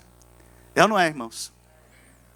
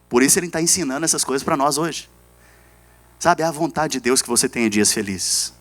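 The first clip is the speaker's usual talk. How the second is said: usual speaking voice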